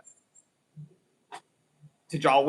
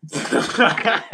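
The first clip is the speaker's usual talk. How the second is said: loud burst of laughter; laughing; 5 dB louder than usual. Real, not acted. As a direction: break into a real laugh. Laughs at own rant.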